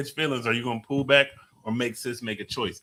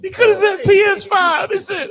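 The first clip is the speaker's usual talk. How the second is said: high, loud, through laughter; laughing shout; 9 dB louder than usual. Real, not acted. Host reacting to the silly toy answer, laughing shout. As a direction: cry out while cracking up. Cracking up over the Xbox answer.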